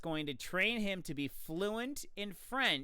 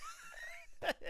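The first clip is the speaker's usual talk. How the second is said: high squeal; 10 dB quieter than usual. Real, not acted. Laughing after Dee Dee voice.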